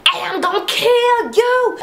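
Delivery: high, loud mock threat to the camera; imitating Arya threatening A. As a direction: squeak out a hammed-up threat.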